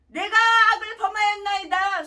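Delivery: loud, high ringing confession; fervent confession. Acted. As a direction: cry out the confession. David's confession quoted.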